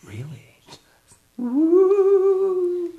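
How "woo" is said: long, high ghostly woo; ghost wail. Pretend. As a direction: hoot loudly like a mock ghost. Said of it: "are you a ghost right now".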